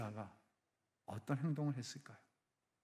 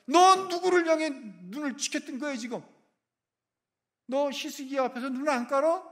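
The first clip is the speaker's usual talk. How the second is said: angry shouting rebuke; 10 semitones higher, 10 dB louder than usual. Acted. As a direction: tear into him like an outraged father. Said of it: "사네립을 향해서 그러는 거예요".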